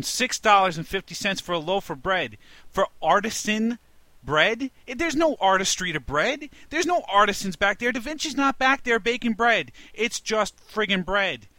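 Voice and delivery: high, loud incredulous ranting; outraged rant. Retold or imitated. Retelling his Panera price shock, loud outraged rant. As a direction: blow up at the price like it's an insult. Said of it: "I literally lost my mind".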